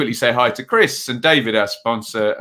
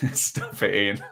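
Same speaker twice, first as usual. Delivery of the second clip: laughing; 3 semitones lower, 8 dB quieter than usual. Real, not acted. Laughing at a viewer's joke.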